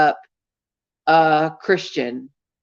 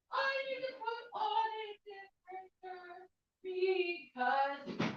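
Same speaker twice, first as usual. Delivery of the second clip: far off mic; 5 semitones higher, 16 dB quieter than usual. Real, not acted. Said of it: "walks off mic to change shirt".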